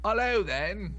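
cheery Cockney hello; Cockney accent. Acted. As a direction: drop the h, London style. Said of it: "Did you just try and talk in a Cockney accent?".

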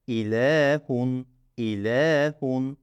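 İlehun with the le drawn out; very slowly stretch İlehun; teacher holding the vowel in İlehun; long L. Shown as a demonstration. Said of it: "L'yi bir miktar uzatıyorum".

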